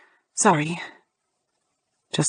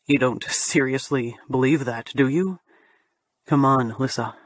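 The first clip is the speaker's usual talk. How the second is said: lower, amused man's voice; low male voice; 7 semitones lower than usual. Acted. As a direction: drop into the man's voice.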